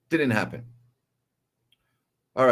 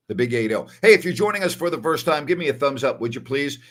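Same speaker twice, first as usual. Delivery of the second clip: usual speaking voice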